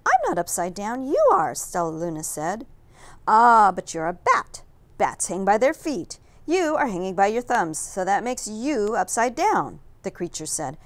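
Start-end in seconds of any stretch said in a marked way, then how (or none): 0.0-1.5 put-on young bat voice. A narrator's reading. Stellaluna answers, rising. 3.3-9.8 bat creature voice. A narrator's reading.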